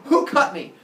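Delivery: loud, high outraged demand; Father Sky. Acted. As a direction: demand the culprit in mock fury. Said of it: "Father Sky gets angry".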